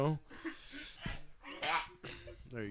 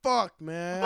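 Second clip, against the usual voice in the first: usual speaking voice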